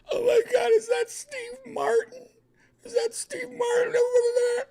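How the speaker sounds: very high, loud, wailing fan squeal; mock starstruck freakout. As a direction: shriek it tearfully, hammed-up. Imagines spotting a celebrity.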